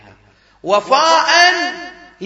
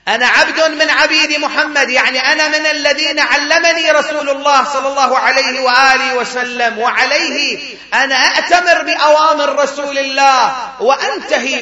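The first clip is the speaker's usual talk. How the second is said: higher and louder, driving the quote; fervent preaching; 4 semitones higher, 6 dB louder than usual. Real, not acted. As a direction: proclaim it with full force. Preacher's climactic quotation.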